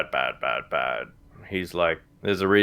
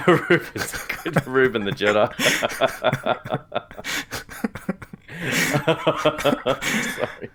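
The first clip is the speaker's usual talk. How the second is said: laughing; 6 semitones higher, slower than usual. Real, not acted. Laughing at own joke.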